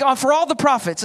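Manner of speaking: ordinary talk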